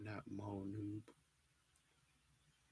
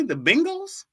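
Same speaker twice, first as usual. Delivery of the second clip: mock high squeak; 4 dB louder, slower than usual. Mocking chat's pronunciation.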